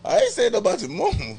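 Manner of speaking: high, quick, laughing backpedal; laughed-out, high-pitched disclaimer; laughing protest. Real, not acted. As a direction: protest loudly through laughter. Laughing disclaimer after teasing her name.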